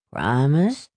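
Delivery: slurred rising question; pleading question, rising at the end; needy father's voice. A narrator's reading. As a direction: ask like a helpless drunk. Drunken father begs reassurance.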